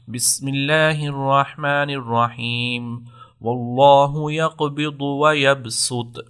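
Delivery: slow melodic tajweed recitation; Quran recitation. Shown as a demonstration. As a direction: recite slowly in tajweed style. (آہستہ تجوید کے ساتھ تلاوت)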